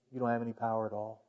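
Jesus's answer repeated, fading to a hush; near-whispered repeat. Retold or imitated. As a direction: let it fall away to almost nothing. Repeated, fainter and faster.

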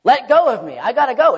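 urgent demand. Retold or imitated. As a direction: angel insisting loudly, in a hurry to leave. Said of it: "And the angel's like".

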